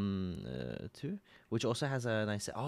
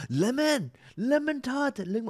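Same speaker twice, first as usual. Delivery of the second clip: calling out; 12 semitones higher, 4 dB louder than usual. Real, not acted. Calling out to a chat member.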